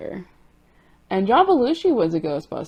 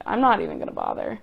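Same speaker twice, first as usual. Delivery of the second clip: much lower, fast, fed-up mutter; giving up; 14 semitones lower, 4 dB quieter, faster than usual. Retold or imitated. Giving up on false eyelashes, much lower fed-up quip. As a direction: brush it off in a sunken, quick voice. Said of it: "and I was like".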